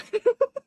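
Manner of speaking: squeaky giggle; a burst of high laughter. Real, not acted.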